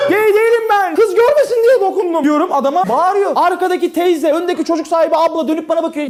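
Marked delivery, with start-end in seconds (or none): 0.1-2.2 panicked protest. Retold or imitated. Panicked explanation to man.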